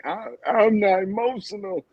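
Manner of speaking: high-pitched imitation of talking through tears; mock tearful denial. Co-host imitating the crying wife's denial, mock tearful. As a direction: barely get it out, as if crying. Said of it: "She could barely get the words out because she was crying".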